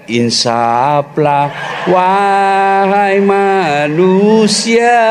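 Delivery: very slow, solemn sermon voice; hammed-up very slow preaching to calm his wife; mock preacher. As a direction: draw it out like a grand sermon.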